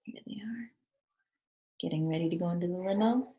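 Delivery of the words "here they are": hushed aside; whisper. Real, not acted. Reader hushes while showing the picture, whispering. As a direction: lean in and whisper. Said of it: "reader whispers aside to child".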